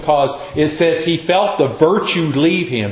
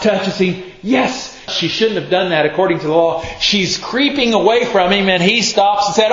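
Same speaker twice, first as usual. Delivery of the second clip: usual speaking voice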